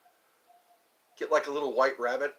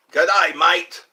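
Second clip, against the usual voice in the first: louder, broad Australian greeting; Australian accent, call out a hearty hello; 2 semitones higher, 12 dB louder than usual. Pretend. Practising an Australian accent for viewers, loud Australian greeting. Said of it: "I'm working on my Australian accent".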